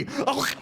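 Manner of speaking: a laughing, shouted ooh; yelp. Acted. Griffin biting his own leg, laughing yelp. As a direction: yelp as the bite lands.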